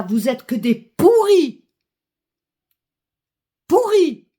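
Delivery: real anger bursting on pourris; furious denunciation. Real, not acted. Citizen denouncing leaders as rotten, furious. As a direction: hurl the insult, climbing on the repeat.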